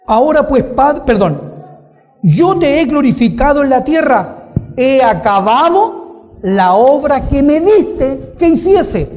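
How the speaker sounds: lectura bíblica fuerte y aguda, alargando frases; prédica a plena voz. Acted. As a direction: proclama el versículo con fuerza y tono elevado. Preacher reads verse forcefully. (predicación enérgica en voz alta)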